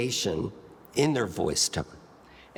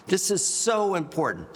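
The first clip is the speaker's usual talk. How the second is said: raised volume demo; 8 semitones higher than usual. Shown as a demonstration. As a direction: lift the voice to stress it. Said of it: "So I've raised my volume".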